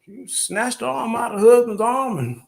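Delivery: high Southern storytelling drawl; animated Southern comedian telling; drawn-out Southern accent. Acted. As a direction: land the punchline in Southern twang. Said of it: "drawn Southern accent".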